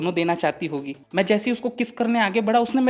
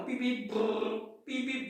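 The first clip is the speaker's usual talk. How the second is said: soft mouth-made honks and engine brrr; quiet mock horn beeps; imitated car horns; 4 semitones higher, 12 dB quieter than usual. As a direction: fake the traffic so she believes you. Fakes traffic noise on call.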